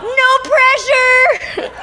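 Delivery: high, slow teasing call from the crowd; imitating a stranger yelling from the stands; mock crowd yell. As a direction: call it out like a heckler. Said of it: "somebody yells out".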